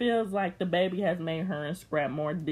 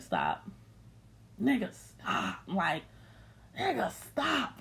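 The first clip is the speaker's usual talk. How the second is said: slow, soft, flat "stop"; quiet, slow deadpan telling him to stop; unimpressed dismissal; 5 dB quieter, slower than usual. Real, not acted. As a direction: drag it out, totally unimpressed. Dismissing Scrap's shock.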